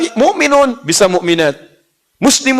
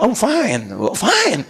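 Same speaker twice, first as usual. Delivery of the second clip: imitated English accent, pitched up; 4 semitones higher, much slower than usual. Shown as a demonstration. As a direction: answer like an English native speaker. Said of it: "Kayak orang Inggris". (logat Inggris tiruan)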